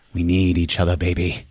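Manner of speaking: low, hushed come-on; creepy seducer. Retold or imitated. Paul pleading with the woman, creepy seducer. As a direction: coax her in a low, soft voice.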